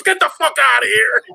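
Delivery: imitating an exasperated cop, high loud shout; shouted dismissal. Trooper turning down a drunk driver's beer offer, shouted dismissal. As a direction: send him packing with a high-pitched yell.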